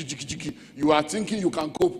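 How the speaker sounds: ordinary talk, nothing marked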